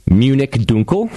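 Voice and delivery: Dunkel as "DOON-kel"; German pronunciation. Shown as a demonstration. German take set against English.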